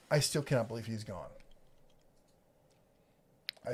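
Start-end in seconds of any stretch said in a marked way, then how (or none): 0.1-1.3 lower, softer, heartfelt admission; sincere sadness. Real, not acted. Host confessing he cannot believe the man is gone, subdued sincerity. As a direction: let the voice sink in honest grief. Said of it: "of honesty and, and sincerity here".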